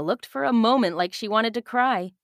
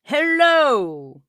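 captain's slow greeting; 7 semitones higher, 6 dB louder than usual. Acted. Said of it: "he said slowly".

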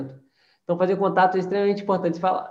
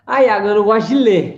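mock excuse; 2 semitones higher, 8 dB louder than usual. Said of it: "student's excuse mocked".

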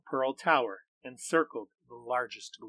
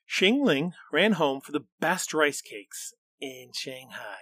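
usual speaking voice

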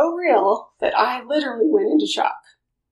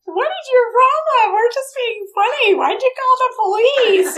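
much higher, mock-baffled sister; sister's indignant reply; 11 semitones higher, 4 dB louder than usual. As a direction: play the sister who finds it all funny. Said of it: "she looked at me like".

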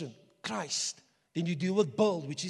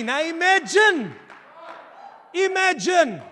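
shouted imagine; 8 semitones higher, 11 dB louder, slower than usual. Real, not acted. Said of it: "preaching at full force".

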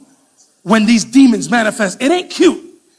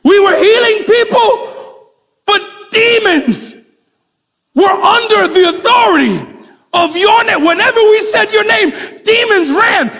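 much higher, louder, shouted report; excited disciples; 10 semitones higher, 3 dB louder, slower than usual. Retold or imitated. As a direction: burst out with wild excitement. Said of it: "They were like".

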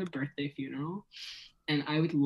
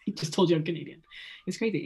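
ordinary talk, nothing marked

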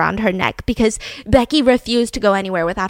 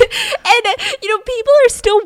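high, louder words through a laugh; 12 semitones higher, 5 dB louder than usual. Real, not acted. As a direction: keep telling it while laughing. Laughing after co-host's interjection.

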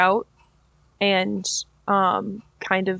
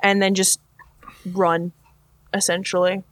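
usual speaking voice

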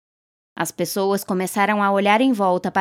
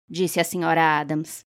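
plain reading voice

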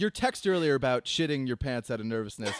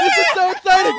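much higher, much louder fake-excited scream; excited scream on cue; 12 dB louder than usual. Host leading everyone in a college-acceptance scream, loud and high. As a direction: scream it out as if the news is huge. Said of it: "Let's all scream".

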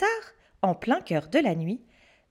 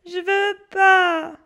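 put-on higher, slow, whining boy's refusal; child whimpering no; whiny refusal; 9 semitones higher, 5 dB louder, much slower than usual. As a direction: drag it out like a frightened child. Scared boy refusing.

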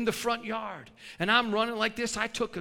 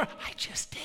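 cheeky unvoiced whisper; sly little whispered aside; 9 dB quieter than usual. Real, not acted. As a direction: drop to a conspiratorial whisper. Cheeky aside after daring question.

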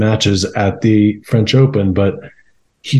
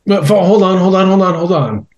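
urgent stop; 9 semitones higher than usual. Real, not acted. Urgently stopping the guess.

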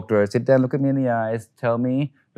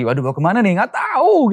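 higher, louder anxious fretting; 10 semitones higher, 4 dB louder than usual. Retold or imitated. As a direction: fret out loud in a rising voice. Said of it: "Jadi lebih anxious lagi".

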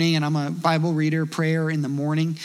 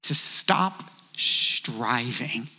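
very slow and low, each word weighed; a drawn-out, low statement to the congregation; slow emphasis; 6 semitones lower, much slower than usual. Shown as a demonstration. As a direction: let it sink in slowly. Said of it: "I'll say it in the negative".

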